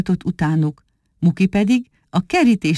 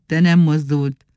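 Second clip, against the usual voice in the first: usual speaking voice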